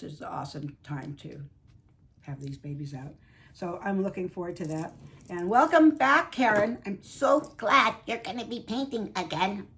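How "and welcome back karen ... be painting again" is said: a hint of a cackling witch. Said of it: "sounded like a little witch person there".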